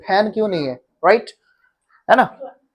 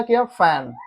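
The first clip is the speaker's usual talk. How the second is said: normal talking voice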